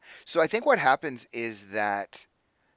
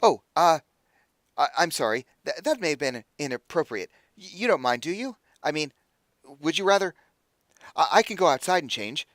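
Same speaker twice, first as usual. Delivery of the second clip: higher, stammering apology; embarrassed realization; 5 semitones higher, 4 dB louder than usual. Acted. Halfway undressed before a visitor, flustered and embarrassed. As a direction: backpedal awkwardly and politely. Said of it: "he realizes, like".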